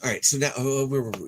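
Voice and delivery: ordinary talk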